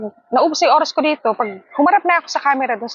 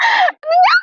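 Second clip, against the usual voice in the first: laughing; 5 dB louder, slower than usual. Real, not acted. Laughing fit.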